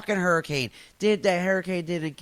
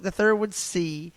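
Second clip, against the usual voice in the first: normal talking voice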